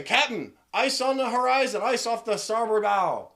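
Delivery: high, loud sailor's alarm; urgent seafaring yell; shouting lookout. Acted. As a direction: call out danger to the captain. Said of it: "you hear somebody say".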